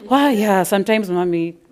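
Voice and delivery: loud, hammed-up childish whine; mock wailing apology. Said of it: "I was shouting".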